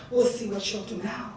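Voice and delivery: low, soft scheming aside; sly plotting. Acted. God hiding his trap for the goddess, sly and hushed. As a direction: say it secretly to yourself.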